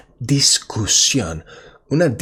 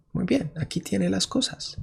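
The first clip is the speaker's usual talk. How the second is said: clerk voice; 9 semitones higher, 6 dB quieter, faster than usual. Acted. Teacher voices shop clerk.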